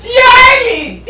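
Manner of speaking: high shriek then laughter. Real, not acted. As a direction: yell the name, giggling. Screaming name, then laughing.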